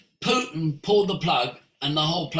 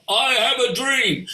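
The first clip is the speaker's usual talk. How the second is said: lightly imitated rousing speech, pitched up; mock orator; 6 semitones higher, 4 dB louder than usual. Speaker listing voices on the satellite, mock orator. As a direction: proclaim it like a famous speech.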